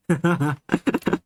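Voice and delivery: giggles tailing off; amused chuckle. Real, not acted. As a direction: chuckle as the laughing winds down. Amused by the chat.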